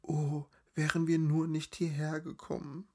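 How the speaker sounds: high, breathy wail held down; fearful whispered lament. A narrator's reading. Hiding woman regrets coming here, high and fearful. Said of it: "flüsterte Lazaralen".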